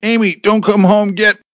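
high, loud urgent warning cut short; urgent yell, broken off abruptly; calling out. A narrator's reading. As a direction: shout a desperate warning down the phone. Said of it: "then Jerry calling out".

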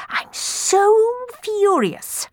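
very high, stretched-out fury; slow squeal of rage; rasping fury. A narrator's reading. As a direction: seethe through gritted teeth, dragging each word. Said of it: "rasped Clorinda between gritted teeth".

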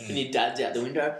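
ordinary talk, nothing marked

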